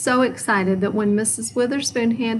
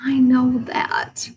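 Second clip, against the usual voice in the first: embarrassed whisper; 5 semitones higher, 4 dB louder, slower than usual. A narrator's reading. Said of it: "cried Froggy".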